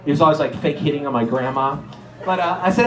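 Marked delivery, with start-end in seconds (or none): none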